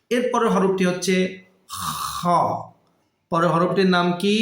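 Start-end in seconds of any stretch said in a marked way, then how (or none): 1.7-2.3 "খ" scraped from the end of the throat, softer than the talk; back-of-throat kha. Shown as a demonstration. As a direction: open "খ" with a long throat fricative. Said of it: "হলকের শেষ ভাগ হতে". ("খ" গলার শেষ ভাগ থেকে ঘষে উচ্চারণ)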